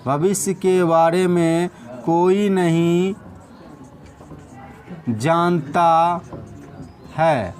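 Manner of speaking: slow and broken up; slow dictation. Shown as a demonstration. Teacher dictating to students, slow. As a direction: dictate each phrase slowly. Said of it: "continues dictation".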